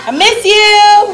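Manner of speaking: stretched high and loud, slow; tipsy, sing-song I miss you; drawn-out tipsy farewell. Real, not acted. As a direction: draw out each word, high and loud. Drunk party goodbye, drawn out.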